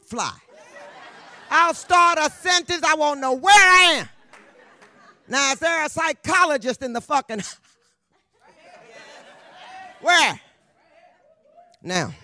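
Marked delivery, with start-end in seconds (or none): 1.5-10.4 high, loud, running off the rails; excited rant. Real, not acted. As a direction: throw it out in a frantic burst. Loud high rant about her memory.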